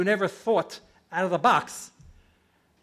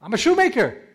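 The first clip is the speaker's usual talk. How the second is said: bewildered protest; 7 semitones higher, 7 dB louder than usual. Retold or imitated. Repeated bewildered answer.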